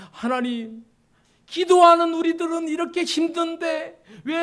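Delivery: loud, high-pitched indignant challenge; confronting, arguing. Acted. Prophet demanding of God why sinners prosper, confrontational protest. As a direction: argue with God as if demanding an answer. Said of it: "마치 하나님 앞에 막 따지듯이".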